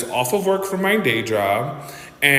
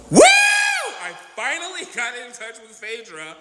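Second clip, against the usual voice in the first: excited; 8 semitones higher than usual. Real, not acted. Celebrates reaching Phaedra.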